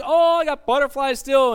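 high, loud mock swooning; giddy lovestruck. Preacher acting out a couple six months into dating, giddy. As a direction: gush like someone newly in love.

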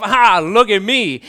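loud, slow mock boast; slow, blaring attention-grabber; emphatic show-off. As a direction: demand all eyes on you, hammed-up. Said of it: "you're making an emphatic statement of".

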